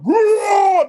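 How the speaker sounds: loud, high imitated roar; lion roar. A lion out in the wild, roaring loudly. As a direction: let out a big mock roar. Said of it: "The lion like".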